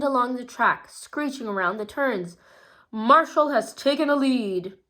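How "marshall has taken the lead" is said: loud, higher race call; announcer. A narrator's reading. Ron the announcer calling Marshall taking the lead, loud. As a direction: announce it over the track. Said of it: "announced Ron Rapidfire".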